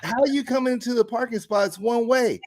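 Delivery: high, incredulous protest; exasperated pointing out. Retold or imitated. Speaker arguing about the one-way lot, high and incredulous. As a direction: make her see the one-way sign. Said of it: "And I'm like".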